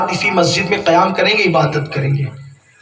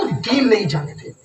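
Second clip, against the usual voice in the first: building rebuke; 6 semitones higher than usual. Acted. Preacher lists what youth ignore.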